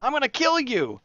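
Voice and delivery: high-pitched hammed-up fury at the sabertooths; mock rage. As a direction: play-act a tantrum, pitch way up. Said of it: "I don't really get mad".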